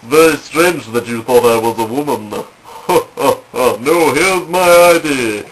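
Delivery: feigned amusement, stretched-out words; acted innocent bluff to officers. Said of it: "I can just go".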